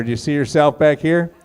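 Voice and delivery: usual speaking voice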